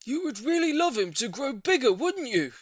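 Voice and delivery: high, loud leading question; probing neighbour. A narrator's reading. Neighbour sounding out the lady's wish, high loud. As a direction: coax a yes from her. Mr Hoppy voiced.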